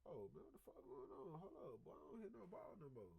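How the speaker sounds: high-pitched, mock-concerned questioning; puzzled parent. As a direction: act out a parent suddenly noticing the silence. Acts out parent noticing the silence.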